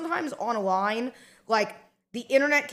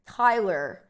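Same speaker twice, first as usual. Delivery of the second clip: ordinary talk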